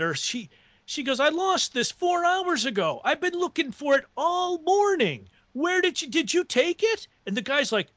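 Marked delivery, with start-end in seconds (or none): 1.2-7.1 high-pitched imitated distress and accusation; upset accusation. Waitress finds her lost bracelet on customer's table, high indignant accusation. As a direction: act out an upset woman demanding answers.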